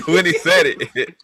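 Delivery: ordinary delivery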